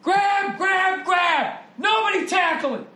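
high, hammed-up old coach bellowing from the sideline; Vince Lombardi yelling. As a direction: play the furious old-school coach. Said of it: "walking up and down the sideline going".

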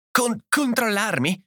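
high, slow, stammered exclamation; voice breaking. A narrator's reading. Edith told to control herself, voice breaking. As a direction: stumble on the first syllable, incredulous and upset. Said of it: "esclamò Edith con la voce rotta".

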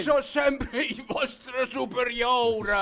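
slow, drawn-out protest; indignant. Acted. Sergeant asserting his rank to mutinous soldiers, indignant. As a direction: stretch every word in outraged authority.

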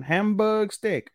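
"Hamburg steak" very slow and high; imitated Japan-style pronunciation. Quoting what they say in Japan, stretched "Hamburg steak". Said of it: "that's all they say in J".

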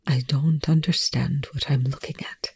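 hushed, whispery admission; soft whisper, barely voiced; breathed. A narrator's reading. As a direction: breathe it out softly. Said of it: "Rush breathed".

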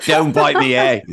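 a jokey Aussie twang, slowed and giggling; mock Australian accent. Host jokingly greets the pet lizard in mock Australian accent. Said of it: "say that with an Australian accent".

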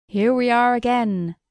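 a hint of a booming pantomime clown. Pow wow man greets the boy like a pantomime clown, lightly booming. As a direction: call it out like a stage clown greeting the crowd. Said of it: "cried he, like the clown in a pantomime".

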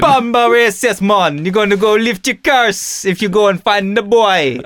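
hammed-up Jamaican accent. Imitating the film villain in a mock Jamaican accent. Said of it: "So he's like".

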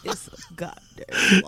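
slow, unvoiced scoffing; mock indignant. Real, not acted. Calling the clerk's claim a lie, mock indignant. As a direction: drag it out scornfully. Joking to friend.

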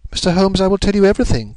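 high-pitched, earnest outburst; emotional cry. A narrator's reading. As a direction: give in with a high, anxious cry. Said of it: "cried the lady".

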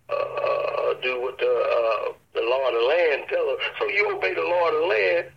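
usual speaking voice

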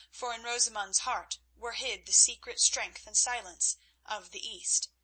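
usual speaking voice

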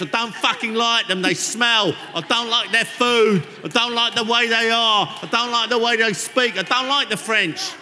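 hammed-up angry Londoner; mock cockney ranting at the French. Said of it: "We've just gone so London".

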